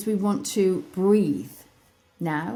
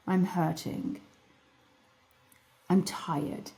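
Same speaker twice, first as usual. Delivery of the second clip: softer, a body part voicing its hurt; small hurting voice; 3 semitones lower, 7 dB quieter, slower than usual. The body answering her gently, soft and small. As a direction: speak it softly like a tired child. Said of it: "talk to it like it's a child".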